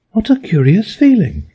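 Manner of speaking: put-on high, wondering girl's voice; Alice, wondering. A narrator's reading. Alice shrinking, high and amazed. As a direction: marvel at the strange feeling.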